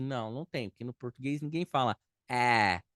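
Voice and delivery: ordinary talk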